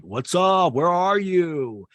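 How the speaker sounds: loud, high, drawn-out holler; mock crazed shouting through doors; wild yelling. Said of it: "sound like a fucking crackhead".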